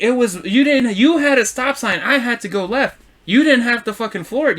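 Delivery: high, insistent accusation; retold heated arguing. Driver blaming the truck driver, retold heatedly. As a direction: argue your case, acted out. Retold road-rage quarrel, teller's argument.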